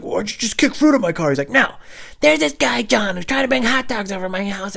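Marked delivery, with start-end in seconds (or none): 0.0-1.3 high, loud accusation; outraged demand. Retold or imitated. Driver confronts man who kicked fruit, high outraged demand. 1.5-4.8 high, loud agitated rant; frantic explaining. Retold or imitated. Prank victim explains himself to the driver, frantic high yelling.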